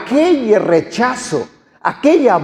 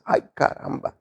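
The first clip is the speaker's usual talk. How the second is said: breathy unvoiced ay caramba; mother mutters it under her breath, backing off; hushed grudging mock surprise; whispered aside; 6 semitones lower, 10 dB quieter than usual. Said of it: "mi mamá se hace para atrás y dice".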